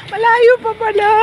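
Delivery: high, loud, amused realization; half-laughing dismay, it's still far; surprised. Real, not acted. Telling viewers the walk is long, surprised.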